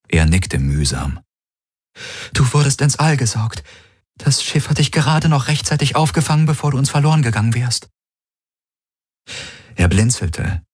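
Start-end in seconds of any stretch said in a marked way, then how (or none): 2.3-7.9 high, fast character voice; concerned woman companion. Acted. Kirai tells Garland how he was rescued, concerned. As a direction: explain it rapidly in her higher voice.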